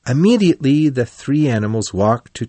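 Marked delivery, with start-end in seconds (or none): none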